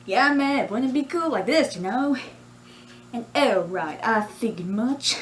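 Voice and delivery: bouncy put-on Australian character voice; lively Australian accent, pitch swinging. Shown as a demonstration. Character chats coolly to viewers, Australian accent. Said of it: "I'm honestly quite proud of this accent".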